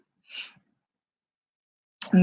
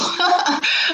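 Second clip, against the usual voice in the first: high, quick nervous laugh to cover tears; a teary laugh breaking out mid-sentence; embarrassed laugh; 12 semitones higher than usual. Real, not acted. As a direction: laugh it off as you tear up. Emotional laugh on live stream.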